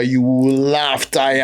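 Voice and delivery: "laugh tire" said slowly in Nigerian pidgin; drawn-out pidgin "you will laugh tire"; pidgin phrase. Shown as a demonstration. Comedian explaining Warri jokes to the host, slow pidgin. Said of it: "jokes in pidgin".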